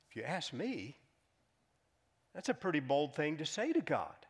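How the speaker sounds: ordinary delivery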